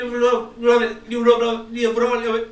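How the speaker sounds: syllables of LIVRO jumbled loudly over each other; overlapping echo confusion. Shown as a demonstration. Teacher showing reflections piling on each other, loud jumbled chant. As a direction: garble the repeated word as if echoes collide. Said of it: "já tá um por cima do outro".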